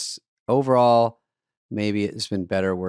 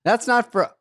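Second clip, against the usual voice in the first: mock protest; 11 semitones higher than usual. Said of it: "And I'm like this".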